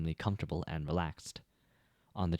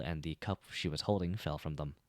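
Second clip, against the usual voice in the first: plain reading voice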